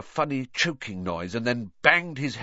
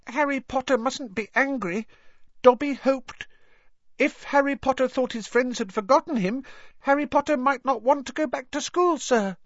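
high, pleading little elf; squeaky anxious house elf. Acted. Dobby the elf speaking.

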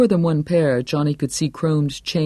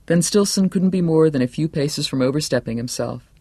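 ordinary delivery

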